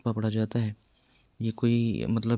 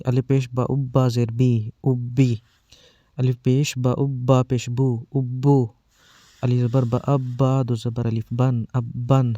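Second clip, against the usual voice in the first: slow, spelled-out syllable drill; firm, paced spelling recital for children; drill reading; 4 dB louder than usual. Shown as a demonstration. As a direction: read each sound firmly with pauses, like a teacher drilling kids. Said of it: "سختی سے اور رک رک کر".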